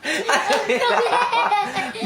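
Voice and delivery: high, long burst of laughing; amused high-pitched laugh; real laughter. Real, not acted. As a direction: crack up helplessly. Laughs at child's TikTok antics.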